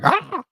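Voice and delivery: short high yelp of fright; imitated scream. Scared by a bird hitting the windshield, a high shriek. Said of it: "I screamed. I was like".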